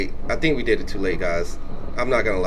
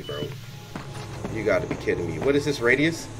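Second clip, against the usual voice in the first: ordinary talk, nothing marked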